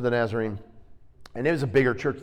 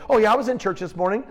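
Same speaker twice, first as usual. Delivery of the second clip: mocking churchgoer; 7 semitones higher, 3 dB louder, faster than usual. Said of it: "the gall to say".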